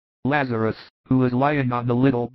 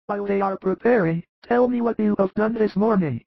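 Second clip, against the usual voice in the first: much higher, weak sick man, tender and grateful; frail Lazarus; 9 semitones higher than usual. Acted. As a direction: feeble high voice of a sick man. Lazarus asking.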